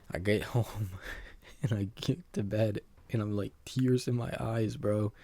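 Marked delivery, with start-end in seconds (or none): none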